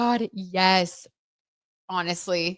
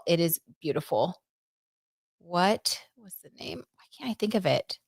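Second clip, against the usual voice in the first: ordinary talk, nothing marked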